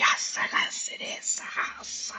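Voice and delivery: breathy snake hiss; Parseltongue hiss. Shown as a demonstration. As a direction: hiss like a serpent. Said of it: "like Parseltongue from Harry Potter".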